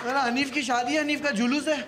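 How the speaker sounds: loud, high, laughing call-out; mock announcement. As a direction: shout it out like a wedding herald, cracking up. Announcing the friend's wedding procession.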